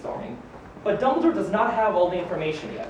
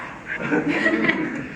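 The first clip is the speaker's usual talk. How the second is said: airy chuckle; 7 semitones higher than usual. Acted. Waving off being called a hypocrite, an airy chuckle.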